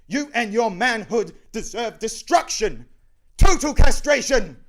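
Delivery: high, furious yell after a fugitive; enraged, piercing pronouncement. Acted. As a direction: scream the threat at full force. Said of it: "yelling after him".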